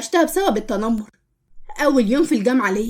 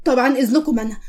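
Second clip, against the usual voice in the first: ordinary delivery, nothing marked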